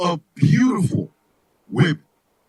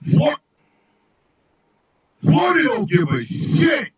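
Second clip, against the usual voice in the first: usual speaking voice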